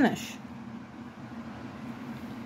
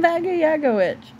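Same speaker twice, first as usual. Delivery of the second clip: amused laughing; 8 semitones higher than usual. Real, not acted. Amused repeat of child's words.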